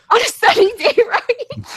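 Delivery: high laughing squeal. Real, not acted. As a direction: giggle through the words. Laughing at silly logo idea.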